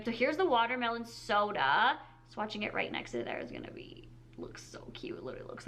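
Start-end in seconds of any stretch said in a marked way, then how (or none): 2.3-5.7 whisper. Real, not acted. Swatching quietly on her hand.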